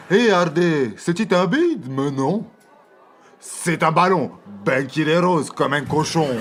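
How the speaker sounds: hammy rustic yokel voice; country bumpkin. Acted.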